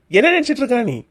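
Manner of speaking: angry rebuke. Acted. Husband rebuking wife for threatening children, angry. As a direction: confront her, pitch jumping up.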